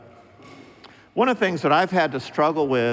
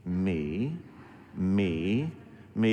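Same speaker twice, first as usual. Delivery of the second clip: low, soft interjections, spaced apart; imitating Bill Murray; 6 semitones lower, 7 dB quieter, much slower than usual. Movie suitor quietly claiming each listed quality, low and soft. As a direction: murmur each "me" under someone else's talk. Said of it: "He's going".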